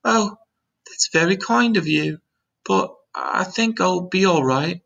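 put-on high, polite young boy; James's polite child voice. A narrator's reading. James answering the ladybug's kind offer, polite high voice. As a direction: decline kindly in a high voice.

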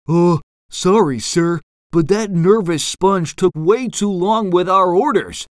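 sheepish. A narrator's reading. Eel leader excusing himself to angry owner, sheepish. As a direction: make an awkward excuse to the boss. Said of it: "the eel leader said sheepishly".

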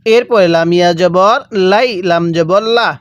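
ordinary delivery

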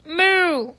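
cow moo. Pretend. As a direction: moo playfully. Mooing about her outfit.

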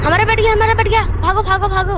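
high, loud alarmed cry; panicking as the bed falls, high and loud; panicked shout. Real, not acted. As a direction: scream the bad news and tell them to run. Bed destroyed, fleeing.

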